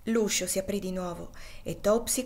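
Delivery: ordinary delivery, nothing marked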